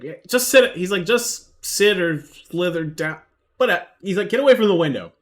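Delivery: loud NPC order. Acted. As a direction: yell at the snake to get down. NPC orders snake away.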